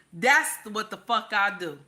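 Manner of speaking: ordinary talk, nothing marked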